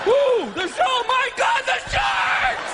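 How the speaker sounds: high frantic shark panic; panicked shriek. Retold or imitated. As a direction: scream as the sharks close in.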